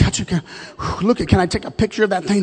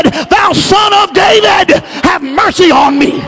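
blind man's cry; 10 semitones higher, 5 dB louder, slower than usual. Retold or imitated. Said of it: "he was calling on the Lord".

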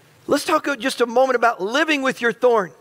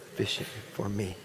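soft repeat; 12 semitones lower, 14 dB quieter than usual. Real, not acted. Congregation repeats softly.

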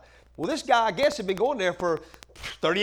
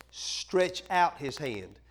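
slow drawn emphasis; much slower than usual. Shown as a demonstration. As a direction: stretch out his hand said slowly with weight on out.

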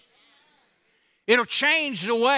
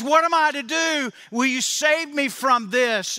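high, anxious plea; pleading man; 5 semitones higher than usual. Retold or imitated. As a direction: beg anxiously, pitched up. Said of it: "And he says".

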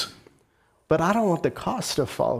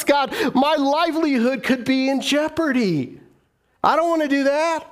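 pleading excuses to God; 6 semitones higher than usual. Retold or imitated. Voicing worker pleading with God.

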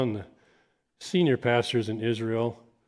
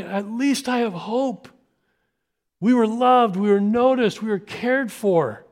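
slow, high, heartfelt; grateful hope; 8 semitones higher, 3 dB louder, slower than usual. Retold or imitated. Family leaving church hopeful, slow and heartfelt. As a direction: let the gratitude land word by word. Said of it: "they walk going".

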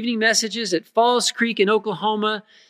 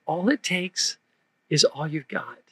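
softer, measured delivery with a pause midway; gentle retold answer; 4 semitones lower, 6 dB quieter than usual. Counselor answers a crying little girl, softly. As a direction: say it softly, sinking lower on the second half. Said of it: "and I said".

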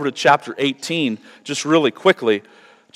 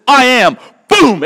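booming declaration; 10 semitones higher, 8 dB louder, slower than usual. Retold or imitated. Jesus's answer topples the soldiers.

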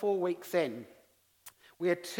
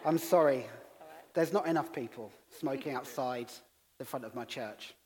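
put-on own English accent; 5 semitones lower than usual. Pastor corrects himself replying to the woman, calm English accent. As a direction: answer evenly in a plain English accent, sinking lower. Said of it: "I said in my English accent".

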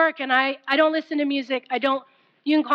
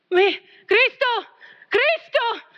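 mock tearful gasping; imitated crying man; 9 semitones higher, slower than usual. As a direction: imitate someone overcome, gasping between words. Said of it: "he started doing this".

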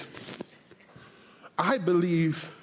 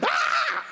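very high mock scream; wordless preacher's screech at breaking point; imitated frenzied scream. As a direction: scream as if losing it completely. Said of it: "And so when he screamed".

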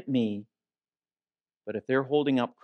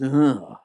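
kid's mock "ugh"; disgusted groan; 4 dB louder than usual. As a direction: groan like a kid sick of the same dinner. Kid's reaction to pizza again.

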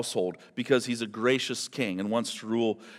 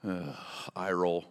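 disdainful sigh; 9 semitones lower, 7 dB quieter, slower than usual. Pretend. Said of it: "casts you a glance and like".

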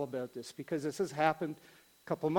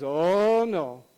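drawn-out, swelling "oh" that falls away; dismayed; 5 dB louder than usual. Retold or imitated. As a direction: groan it in mock dismay. Bob realizes his mistake.

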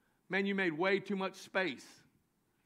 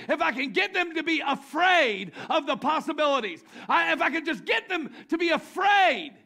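loud, higher scheming Satan voice; Satan plotting to make them fearful, shouting; 7 semitones higher, 7 dB louder than usual. Acted. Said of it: "He's like".

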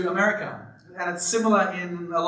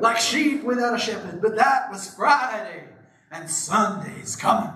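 preacher voice; 4 semitones higher than usual. Retold or imitated. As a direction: draw it out like a Baptist preacher warming up. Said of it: "Yeah, do it".

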